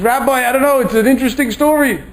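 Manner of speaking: put-on girl telling the rabbi her story in a high child's voice; little girl voice. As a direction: chatter it up high like a six-year-old.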